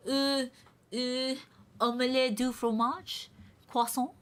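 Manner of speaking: high hammed-up French accent; mock French, over the top. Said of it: "give me something very French to say".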